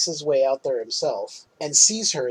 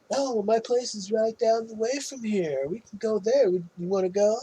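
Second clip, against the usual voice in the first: higher-pitched, put-on inviting voice; hammed-up eager invitation; imitated one-sided chat; 6 semitones higher, 4 dB quieter than usual. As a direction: coax an imaginary date along. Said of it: "having a full-on conversation with no one".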